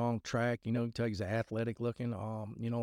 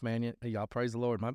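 ordinary delivery

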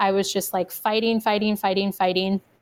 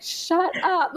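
retold snapping; 8 semitones higher than usual. Labouring wife to over-excited husband, mock exasperated snap. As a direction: imitate shushing someone in exasperation.

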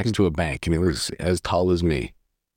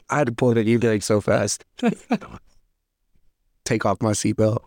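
ordinary talk

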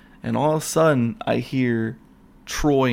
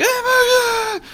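very high, slow, louder shriek of fright; mock terrified; 6 dB louder, slower than usual. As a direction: ham up a horror-movie scream. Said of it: "And I'm like".

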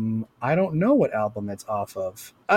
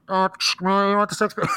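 a raised, dopey drive-thru clerk; 6 semitones higher than usual. Retold or imitated. Podcast host imitating the drive-thru clerk from the skit, raised and dopey. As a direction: play the baffled worker at the window. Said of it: "the Drive-Thru voice".

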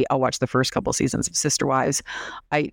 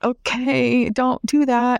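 nicer friend; 7 semitones higher, much slower than usual. Retold or imitated. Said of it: "the one friend was much nicer".

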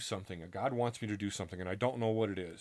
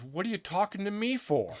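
higher, louder mock challenge; mock in-your-face retort; 9 semitones higher, 4 dB louder than usual. Priest confronts the storyteller with a hammed-up challenge. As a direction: throw it right in his face, half-joking.

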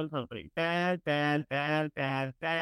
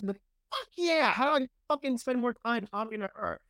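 excited; 7 semitones higher than usual. Real, not acted. Excited outburst about senator.

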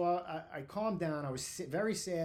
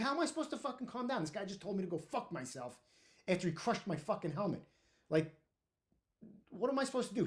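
high-pitched indignant rant; retold aggrieved outburst; exasperated protest; 5 semitones higher than usual. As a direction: plead your case, still worked up. Retold furious protest to the captain.

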